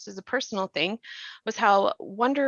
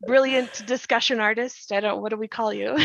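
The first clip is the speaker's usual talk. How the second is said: ordinary delivery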